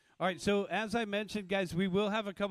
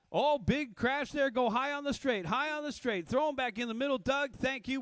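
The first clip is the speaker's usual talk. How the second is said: excited crash call; 8 semitones higher than usual. Real, not acted. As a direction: react to the crash live. Crash during race call.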